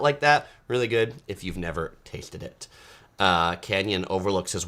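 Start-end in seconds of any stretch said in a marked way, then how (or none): none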